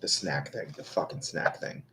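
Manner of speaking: soft, half-whispered grumble; muttered to self. Real, not acted. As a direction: grumble it under your breath.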